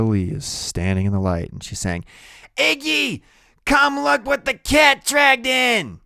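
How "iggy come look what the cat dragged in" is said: much higher, slow, loud tipsy woman; drunk woman calling out. Acted. Loretta calling to Iggy at the door, loud and tipsy. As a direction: sing it out across the room, half drunk.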